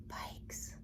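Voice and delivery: hushed gasp and whisper. A narrator's reading. Animals spotting the parked bikes, awed whisper. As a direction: breathe it in awe.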